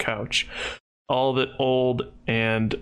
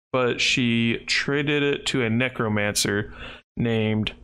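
ordinary talk

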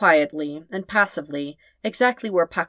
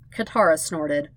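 plain reading voice

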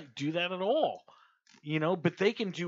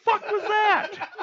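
high, loud, drawn-out disbelief; hammed-up bewildered shout, cracking up; baffled yell; 8 dB louder, slower than usual. Reacting to an unintelligible Texan, hammed-up baffled yell.

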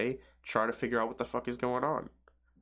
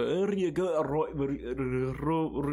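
garbled, high, exaggerated Newcastle mumbo jumbo with rolled R's; mock Newcastle accent; 5 semitones higher, 3 dB louder, slower than usual. Local giving directions to a visitor, mock Newcastle accent. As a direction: parody an unintelligible Newcastle accent, rolling the R's. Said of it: "you ask a guy for, um, directions in, like, Newcastle".

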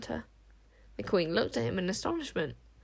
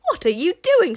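high, loud outraged surprise; astonished; 10 semitones higher, 9 dB louder than usual. A narrator's reading. Witch smeller disarmed by the queen, astonished. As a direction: burst out in disbelief. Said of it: "said the witch smeller in astonishment".

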